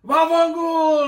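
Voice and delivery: high, loud, slow Italian yell, va fangul stretched out; Italian buddy yelling. Retold or imitated. Italian buddies yelling at each other in school, loud Italian accent. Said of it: "And they yell it".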